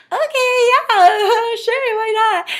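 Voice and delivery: high, loud, giggly agreement; a kid gleefully accepting a card trade; laughing yes. Retold or imitated. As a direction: laugh through a carefree sure.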